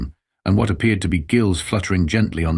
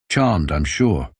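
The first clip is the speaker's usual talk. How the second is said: slow, dry, unimpressed; dry sarcasm; 3 semitones lower, much slower than usual. Acted. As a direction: drawl it out, deadpan. Dry reply to pompous alien.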